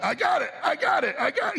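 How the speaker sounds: high, straining shout that turns down help; hammed-up yell of a man refusing help with the furniture; mock shout. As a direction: insist over and over that you've got it. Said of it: "and you're like".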